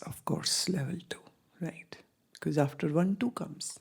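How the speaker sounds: soft whisper, voice dropped away; whispered aside. Real, not acted. Teacher whispering an aside to students about level two. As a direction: hush it like a little secret.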